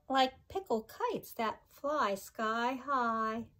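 sing-song rhyming read-aloud, each line bouncing; reading to children. Acted. Teacher reading a pickle rhyme book to her class, sing-song. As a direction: bounce the rhymes for little listeners.

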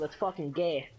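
ordinary talk, nothing marked

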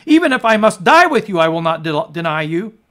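Peter's loud, high insistence; bold loud vow. Acted. Preacher as boastful Peter, loudly protesting loyalty. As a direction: swear it out loud, sure of yourself.

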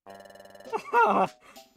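high, loud falling laugh; shrill belly laugh; loud high cackle. Acted. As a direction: burst out in a gleeful cackle.

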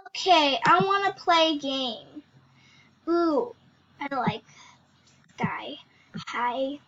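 usual speaking voice

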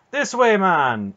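high, loud, slow call. A narrator's reading. Knight calling to the escaping slave in the river, loud. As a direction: call across the water to him. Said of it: "Manderallen called to the swimming slave".

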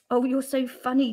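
ordinary delivery, nothing marked

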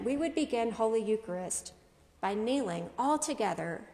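ordinary talk

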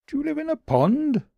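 high, small puzzled bunny voice; a high, wondering little creature; Daily B's voice. A narrator's reading. Daily B asks.

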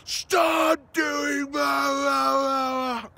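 slow, high, loud shout; yelling. Retold or imitated. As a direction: bellow it to cut through the noise. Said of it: "I yell to her".

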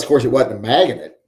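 ordinary talk

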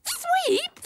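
high-pitched shocked call; startled, voice leaping up; astonished cry. Acted. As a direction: call the name in sudden disbelief. Startled on seeing a second dog.

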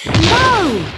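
high, loud cry of alarm; mother stopping the father's gun; shout. Acted. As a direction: stop him shooting. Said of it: "she shouted".

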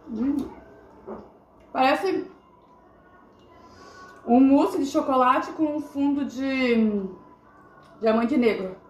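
tasting between words; talking while chewing. Real, not acted. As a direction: mouth partly full of mousse. Seen 0: Woman in blue has spoon at lips, mouth working on mousse.

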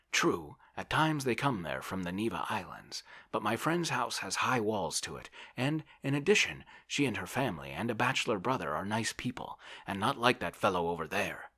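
a touch of bluster; lightly voiced blustering visitor. A narrator's reading. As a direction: lightly play a pushy guest selling an idea. Tarantiev argues for the flat.

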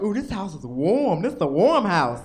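put-on hinting kid.

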